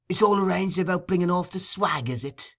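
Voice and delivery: high, eager probing question. A narrator's reading. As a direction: fish for details.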